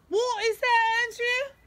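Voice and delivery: loud, high, drawn-out mock British; bad British accent. Mom teasing her son about looking British, hammed-up British accent. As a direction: put on an exaggerated British accent, loudly. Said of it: "That's the worst accent I think I've ever heard".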